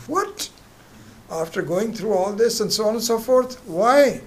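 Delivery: high, loud, disbelieving cry of what; shocked outburst. Acted. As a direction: explode in disbelief at him. Wife exasperated at husband.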